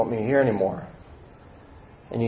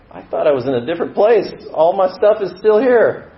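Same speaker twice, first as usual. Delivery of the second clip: high, rising wail of protest; dismayed outcry; 8 semitones higher, 4 dB louder than usual. Acted. Struggler finds the old baggage still there, dismayed outcry.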